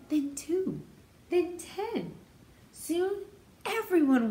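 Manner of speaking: rising, delighted counting; excited build. A narrator's reading. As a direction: climb with each number, thrilled. Purses spreading excitedly.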